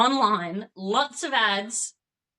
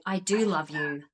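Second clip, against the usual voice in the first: tender reassurance; 7 semitones lower than usual. Retold or imitated. A mum telling her little son why she says no, tender. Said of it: "And I say".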